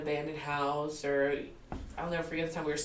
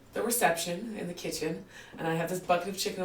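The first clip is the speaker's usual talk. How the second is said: normal talking voice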